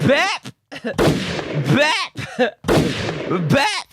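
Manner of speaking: high, punchy mouth-made gunshot sounds; playful pretend gunfire; mock gunshot. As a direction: fire off imaginary shots.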